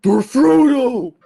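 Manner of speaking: loud, high, drawn-out war shout; mock battle cry. Podcaster imagining Vin Diesel charging as Aragorn, mock battle cry. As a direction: bellow it like a charging movie hero.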